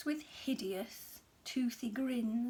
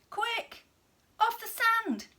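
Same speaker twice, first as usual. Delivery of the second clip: much higher, urgent and frantic; urgent cry; 11 semitones higher, 6 dB louder than usual. A narrator's reading. Snail urging the beached whale back to sea, frantic. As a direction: shout to save him in a hurry. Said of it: "cried the snail".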